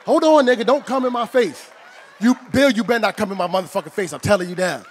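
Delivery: loud, high yelling; angry shout. Real, not acted. Comic yelling at Bill to stay out of his face. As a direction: bark it at him, furious.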